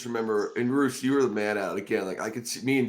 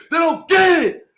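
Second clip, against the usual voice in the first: high, loud, slow scream with a laugh breaking in; wild sideline scream, much higher and louder; 11 dB louder, slower than usual. Retold or imitated. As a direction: scream it like a man who couldn't get enough. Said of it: "And starts screaming".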